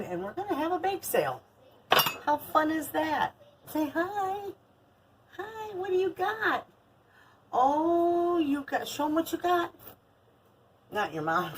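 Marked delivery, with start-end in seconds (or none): 0.0-9.7 put-on high, sing-song baby talk. Grandma cooing at her granddaughter, high baby talk. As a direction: coo it up high to a toddler.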